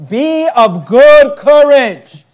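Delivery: exhorting the congregation; declaimed verse. Acted. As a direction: ring it out as a charge. Preacher declaims verse loudly.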